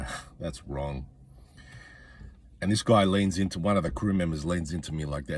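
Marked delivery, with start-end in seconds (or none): 0.0-1.0 slow, soft muttered aside, much quieter; half-swallowed disapproving mutter; mumbled under breath. Retold or imitated. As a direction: grumble it to yourself so nobody hears. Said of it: "I mumbled, mumbled under my breath".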